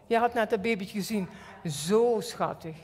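ordinary talk

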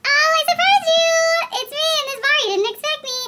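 playful surprise entrance. Acted. Contestant popping up in a bar, high playful surprise. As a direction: sing it out high, easing down.

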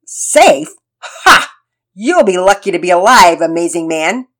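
loud, mocking villain voice; outlaw. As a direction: sneer it like a taunting outlaw. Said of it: "in his best mocking outlaw voice".

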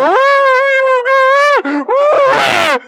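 loud, high mock wailing; mock whining moan. Ranter imitating people who whine and moan, high mock wail. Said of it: "we want to whine and moan".

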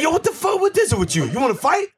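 high-pitched mock aggressor; imitated confrontational challenge. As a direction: challenge him, pitch jumping way up. Retold confrontation with angry man.

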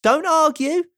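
high, firm character voice; chirpy but insistent; Mister Happy. A narrator's reading. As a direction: cut off the objection, pitched well up. Mister Happy overrules Mister Miserable.

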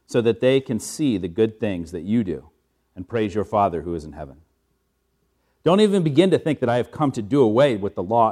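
projected, declaiming gospel reading. Acted. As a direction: proclaim the words of Jesus to the room. Reads the gospel aloud.

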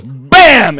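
shouted, high and punchy; loud bam. Acted. The Holy Ghost striking the woman down, shouted. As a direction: slam the word like a blow.

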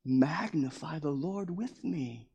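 David softly inviting friends to magnify God with him; soft cheerful call. Retold or imitated. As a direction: draw them in quietly. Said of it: "He's like".

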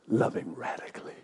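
normal talking voice

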